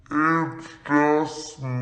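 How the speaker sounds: low, slow, dragged-out mumble; half-asleep sister, low and slow; sleepy reply. Retold or imitated. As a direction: answer low and very slowly, just woken. Sister woken, answering in the dark.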